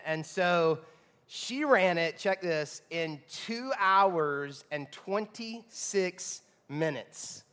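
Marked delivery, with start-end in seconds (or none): 2.9-7.4 very slow, drawn-out for impact; slow emphatic reveal. Real, not acted. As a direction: spell the time out slowly. Dramatic time reveal.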